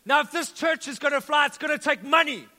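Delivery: preacher's loud build-up. Retold or imitated. Pastor voices a preacher asking the church for money, loud. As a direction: drive it home like a fired-up preacher. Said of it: "the preacher goes on".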